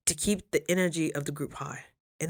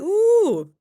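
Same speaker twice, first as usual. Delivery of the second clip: excited ooh; 14 semitones higher, 8 dB louder than usual. Real, not acted. High loud ooh.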